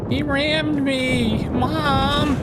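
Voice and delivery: put-on high, slow whiny kid voice; whiny tattling little sibling. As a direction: whine like a tattling little brother. Mock sibling tattling to mom.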